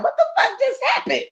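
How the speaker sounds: high-pitched mock bewilderment; baffled reaction. Voices a baffled lover's reaction.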